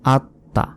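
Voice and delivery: আত্মা split as at, then ta; doubled consonant. Shown as a demonstration. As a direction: close on the t and sound it afresh in আত্মা. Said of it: "এভাবে". (আত্মা-তে ত দুইবার, আত-তা)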